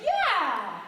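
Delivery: loud cheering shout; chorused yeah. A narrator's reading. Orphans chorusing agreement at the storyteller, loud cheer. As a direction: shout it together, triumphant.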